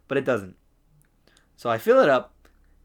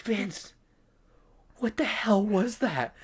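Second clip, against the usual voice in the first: slow, high, whispered disbelief; imitated hushed amazement; stunned whisper; 9 semitones higher, slower than usual. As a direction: whisper it like an astonished coworker. Coworker's stunned reaction, acted.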